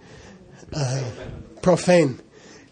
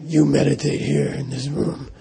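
mean lama voice; 4 dB quieter than usual. Retold or imitated. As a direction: give the order as an imitated mean lama. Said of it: "His voice was like that".

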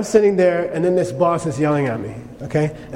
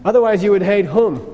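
ordinary talk

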